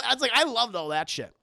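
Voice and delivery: normal talking voice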